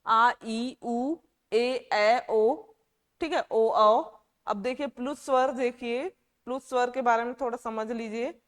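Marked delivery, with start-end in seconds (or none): 0.1-4.2 each vowel आ ई उ ए ऐ ओ औ held long and loud; drawn-out long vowels. Shown as a demonstration. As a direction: stretch every vowel, louder. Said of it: "दोगुना समय लगता है".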